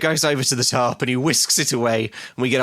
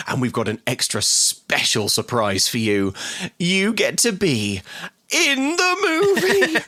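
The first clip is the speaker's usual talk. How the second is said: hammed-up big reveal, stretching the last words higher; prize announcement; 7 semitones higher, slower than usual. Announcer to competition winner, building mock excitement. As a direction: build from measured to high, drawn-out excitement.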